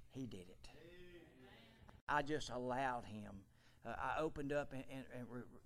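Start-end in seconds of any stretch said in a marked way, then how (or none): 0.1-3.4 humble whisper. Retold or imitated. Crediting God humbly.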